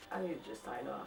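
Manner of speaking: soft, breathy whispered giving up; defeated whisper. Real, not acted. Vlogger done with the bad prose, soft whispered defeat. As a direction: sigh it out, worn out. Defeated sigh after excerpt.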